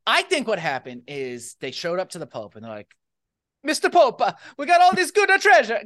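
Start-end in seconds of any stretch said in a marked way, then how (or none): 3.6-5.8 put-on Italian accent. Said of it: "'cause they're Italian".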